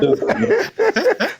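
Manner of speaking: bursts out laughing high; amused high-pitched laugh. Real, not acted. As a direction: laugh it off at the interruption. Laughing at the heckler.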